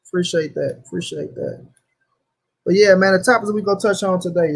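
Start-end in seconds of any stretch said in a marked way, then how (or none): 0.9-1.7 mock deep-voiced thanks, much lower and softer. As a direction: drop the voice way down low and soft for "Appreciate that.". Repeats thanks in two voices.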